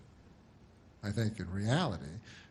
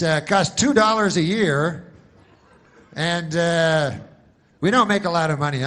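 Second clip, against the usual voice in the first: mock pitchman; 4 semitones higher, 8 dB louder than usual.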